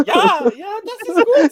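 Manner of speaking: high laughing falsetto German woman; mock German woman. Mocking German porn with a high laughing female voice. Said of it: "In the female".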